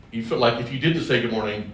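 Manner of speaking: ordinary delivery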